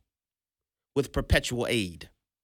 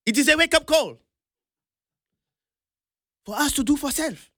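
imitating Kenyan accent; 10 semitones higher than usual. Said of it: "I love the accent".

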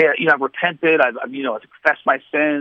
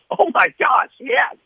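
much higher, astonished "oh my gosh"; the friend stunned it came true; amazed; 13 semitones higher, slower than usual. Retold or imitated. Friend confirms the vision.

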